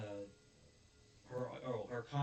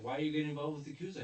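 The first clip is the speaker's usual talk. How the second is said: quick, higher demanding question; rapid question; 5 semitones higher, 5 dB louder, much faster than usual. Retold or imitated. Officer rapidly questioning Onizuka about Yakuza. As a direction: fire off the question fast. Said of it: "and he's like".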